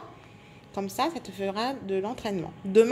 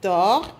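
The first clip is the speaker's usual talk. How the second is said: "tor" set apart as one firm, louder syllable; 2 semitones lower, 7 dB louder than usual. Shown as a demonstration. Teacher counting the syllables of tortue, syllable by syllable. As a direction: sound out "tor" alone as the first syllable. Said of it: "Il y a deux syllabes".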